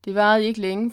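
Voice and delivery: ordinary delivery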